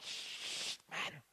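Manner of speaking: hushed, hissed shush and complaint; the cool crowd hushing the blind beggar, quietly. Pretend. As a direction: shut him up under the breath. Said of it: "they're like".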